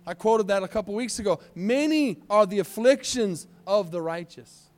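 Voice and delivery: high, loud preacher's cry; fiery sermon delivery; full-force preaching. Acted. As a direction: drive the point home to the church.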